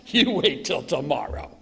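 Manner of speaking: slow, menacing put-on voice; acted threat. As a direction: draw the threat out slowly.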